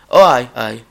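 put-on broad Scottish accent. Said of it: "And he goes".

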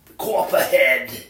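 slow drawn-out mock Arizona accent. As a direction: stretch the word like a joke Scottsdale local. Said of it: "That's how they talk in Arizona".

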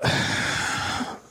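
ordinary delivery

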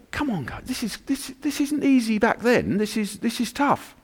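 shocked disciples. Acted. Disciples shocked at risen Jesus.

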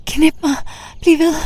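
high pleading cries of pleasure; crying out. Acted. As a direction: scream it out near climax. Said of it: "skriger jeg".

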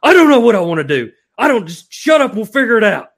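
imitating a grumpy fishing buddy; mock angry outburst. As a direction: retell the friend's irritated retort. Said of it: "And he was like".

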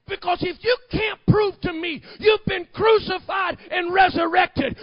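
high, very loud preacher's challenge; full-force preaching. Acted. Preacher challenging a false owner of the church, full-force shout. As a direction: thunder it out in a high pulpit shout.

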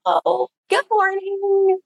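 very high, held, drawn-out greeting; anxious high greeting. Shown as a demonstration. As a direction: squeak out a slow hello on held breath. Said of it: "we talk up here".

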